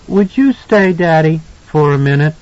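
put-on high, tentative child's request; shy little boy. A narrator's reading. Son asking his father to stay, shy. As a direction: ask shyly as a small boy. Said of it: "He looked shyly at his father".

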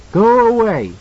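high, loud scream at the monster; frightened boy shouting go away; child's shout. A narrator's reading. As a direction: yell it away from you. Danny yells at the monster.

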